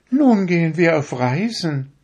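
high, proud little needle voice; a needle showing off how fine it is; vain darning needle. A narrator's reading. To the fingers, a vain needle in a high proud voice. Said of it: "sagte die Stopfnadel".

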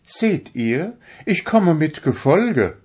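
high, proud little needle voice; a needle showing off how fine it is; vain darning needle. A narrator's reading. To the fingers, a vain needle in a high proud voice. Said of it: "sagte die Stopfnadel".

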